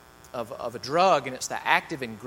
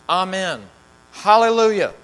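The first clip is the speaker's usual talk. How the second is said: high, loud amen hallelujah; soaring shout of praise; 6 semitones higher, 10 dB louder than usual. Acted. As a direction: proclaim it in worship. Elders worshipping crying out.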